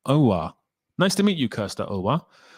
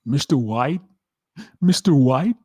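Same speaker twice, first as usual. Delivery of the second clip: higher, questioning American-accent impression; Jesse; 4 semitones higher than usual. Shown as a demonstration.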